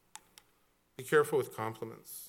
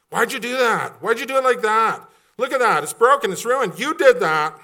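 much higher and louder accusing rant; imitating a blamer; 10 semitones higher, 13 dB louder than usual. Preacher acting out a blamer for the church, mock angry rant. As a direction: point the finger and yell.

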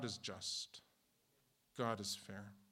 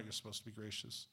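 ordinary talk, nothing marked

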